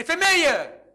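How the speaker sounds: high, loud, ringing command; commanding call. Retold or imitated. Jesus to the weeping widow, loud and commanding. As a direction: call out to stop her grief. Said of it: "Și spune".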